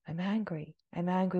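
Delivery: softly, tentatively acted admission; half-hearted admission. Teacher voicing a little sense of anger, half-hearted. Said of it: "a little sense of".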